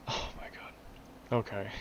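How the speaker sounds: slow, breathy whisper. Real, not acted. Vlogger drained by the car model, slow whisper. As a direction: let it fall out in a whisper. Winding down.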